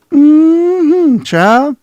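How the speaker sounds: put-on high, loud Southern belle "Mm-hmm, child"; sassy Southern belle. Southern belle sass aimed at the friend. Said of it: "You sound like, Scarlett O'Hara".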